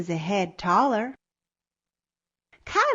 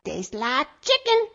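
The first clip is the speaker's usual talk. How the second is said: a touch of chicken squawk, higher; 4 semitones higher than usual. A narrator's reading. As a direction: lightly squawk it as you flee.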